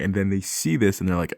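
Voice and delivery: usual speaking voice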